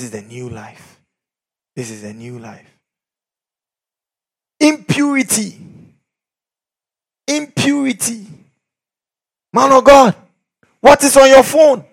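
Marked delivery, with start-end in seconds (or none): 4.6-8.3 called-out accusation. Acted. Preacher calling out sin. 9.5-11.8 high, loud confronting call; loud challenge. Acted. Preacher challenging a man of God, loud confrontation.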